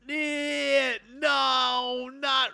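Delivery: squeaky high no, not really; rising into a cracked wail; high protest. Real, not acted. Player protesting the game's suggestion, cracked high squeal.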